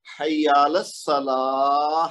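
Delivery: slow "حی علی الصلاح", long laa ending in h; muezzin's stop. Shown as a demonstration. Teacher shows how the muezzin stops, stretched laah. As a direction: draw out "حی علی الصلاح" to a breathed h. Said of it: "موزن جو جب رکتا ہے".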